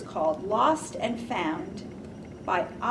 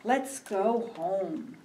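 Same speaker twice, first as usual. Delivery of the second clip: put-on reading to children; slower than usual. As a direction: read gently to little ones. Reads a picture book aloud.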